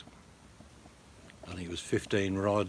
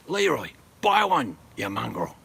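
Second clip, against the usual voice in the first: calling out; 3 semitones higher, 6 dB louder than usual. Real, not acted. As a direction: yell it at Leroy. Calling out to friend.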